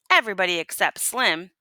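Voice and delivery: ordinary delivery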